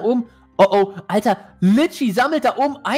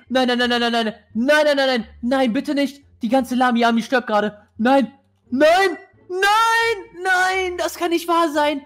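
high frantic nos; panicking gamer; 5 semitones higher than usual. Real, not acted. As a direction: wail no in rising panic. Army dying in game.

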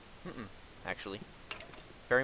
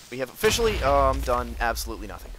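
mock announcement; 8 dB louder, faster than usual. Real, not acted.

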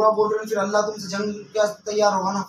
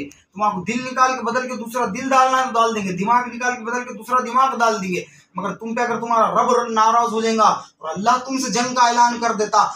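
raised, urgent warning sermon voice; fervent preaching; 4 semitones higher, 5 dB louder than usual. Real, not acted. Preacher warning followers never to speak ill of saints, fervent. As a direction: press the warning on the congregation, voice lifted.

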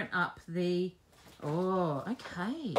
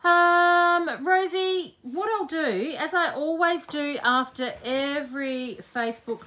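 louder and higher, calling to Rosie; louder, higher addressing viewer; 5 semitones higher, 6 dB louder than usual. Real, not acted.

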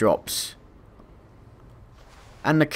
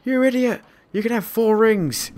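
high, mock yell at the game; retold yelling; 9 semitones higher than usual. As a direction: shout it up high at the screen. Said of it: "we've been screaming at the frickin' thing".

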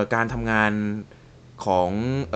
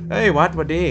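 high, loud startled hey; surprised exclamation; 6 semitones higher, 4 dB louder than usual. Real, not acted. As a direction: caught off guard, then greets. Startled by a new message. (อุทานตกใจเสียงสูงดัง)

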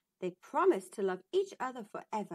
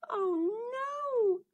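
much higher and louder, a whimpering cry; a princess in despair as the door opens; princess dismayed; 12 semitones higher, 4 dB louder than usual. Acted. Princess sees the frog at the door, whimpering high and loud. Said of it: "said the princess".

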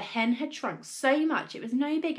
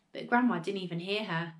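plain reading voice

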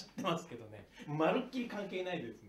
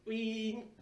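door whoosh sound; 7 semitones higher than usual. Acted. Imitating automatic door.

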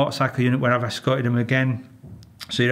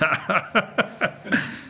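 short airy giggle, pitched up; breathy high laugh; 8 semitones higher, 3 dB quieter than usual. Real, not acted. Police officer laughs at his own joke, high airy giggle.

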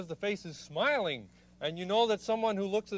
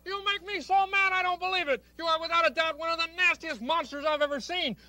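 much higher, ranting furious frog; mock anger demo; 7 semitones higher than usual. Shown as a demonstration. Host demonstrating anger to the monster, higher mock rant. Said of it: "you can tell I'm mad by the kind of things that I say to the monster, like this".

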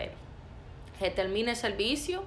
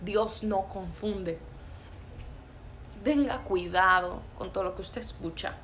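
normal talking voice

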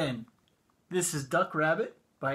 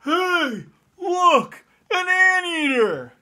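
much higher, louder cry of discovery; excited shout; 10 semitones higher, 6 dB louder, slower than usual. Acted. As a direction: call out like you just spotted it in the sky. Friend spots cloud shape, shouts.

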